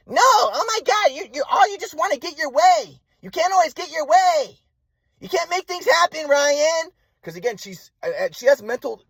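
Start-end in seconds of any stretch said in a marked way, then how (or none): 0.1-6.9 very high, drawn-out mock rant as mom; angry mother imitation. Mom refusing son's plea to move on, high angry mock rant. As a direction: mimic mom's high-pitched scolding, stretching words.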